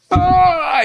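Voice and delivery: big loud rising yawn, pitched high; exaggerated yawn. Shown as a demonstration. Preacher mimics ignoring a speaker, loud mock yawn. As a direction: yawn rudely while someone is talking. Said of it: "Y bostezo".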